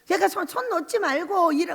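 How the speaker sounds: ordinary talk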